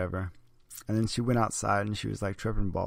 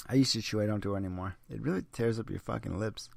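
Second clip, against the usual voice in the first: ordinary delivery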